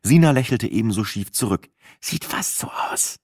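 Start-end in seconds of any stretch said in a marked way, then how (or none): 2.0-3.2 whisper. Acted. Wry whispered reply.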